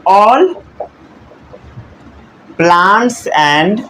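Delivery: very slow, each word set apart for writing down; slow word-by-word dictation. Shown as a demonstration. Teacher dictating to his class, word by word very slowly. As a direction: spell out the sentence word by word for students copying. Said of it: "समझ गए?".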